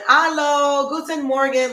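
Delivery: bright, high sing-song hello; cheery German greeting. Real, not acted. Livestream host greeting viewers cheerfully in German.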